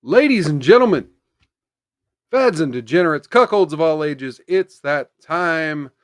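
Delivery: loud showman host opener; announcer intro. Acted. Show host opening the stream.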